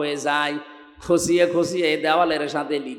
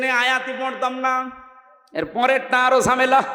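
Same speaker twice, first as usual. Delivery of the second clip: normal talking voice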